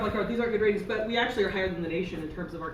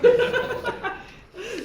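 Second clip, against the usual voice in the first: loud high laugh breaking out; big burst of laughter; 14 semitones higher, 6 dB louder than usual. Real, not acted. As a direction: burst out laughing at the subway slip. Loud laugh at own joke.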